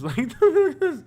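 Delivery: high laughing exclaim. Real, not acted. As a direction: laugh it out in disbelief.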